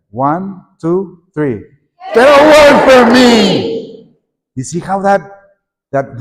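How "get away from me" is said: put-on loud, slow defiant child; class-wide mock rebellion; rebellious child. Shown as a demonstration. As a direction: push them away like a defiant child. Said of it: "So let's all do it together".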